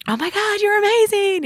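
high, excited gushing voice. Retold or imitated. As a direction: gush at a high squeal. Others gushing over her playing.

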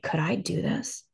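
softly wondering to oneself; quiet retold inner doubt; hushed self-questioning. Retold private doubt on the toilet.

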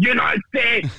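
high, loud football-crowd shout; fan shout. Shown as a demonstration. Fan showing what the crowd yells at matches, loud high shout. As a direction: belt it out like a fan in the stands. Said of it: "mä nyt huudan".

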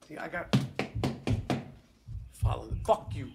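a real muttered curse at the neighbours; muttered aside. Real, not acted. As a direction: grumble it to yourself.

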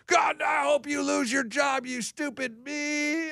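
angry driver insults. Retold or imitated. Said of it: "people yell at 'em all the time".

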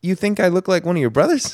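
high, incredulous mock question; mocking awkward reply. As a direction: squeak it out in disbelief.

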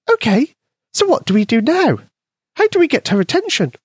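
high, tiny mouse voice; squeaky mouse voice. A narrator's reading. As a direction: voice a small eager mouse. Mouse Penny speaks.

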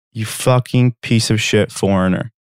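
ordinary talk, nothing marked

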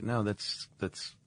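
softly voiced nurse's reply; nurse reassuring family. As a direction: lightly act a nurse, keeping it hushed. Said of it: "And the nurse is like".

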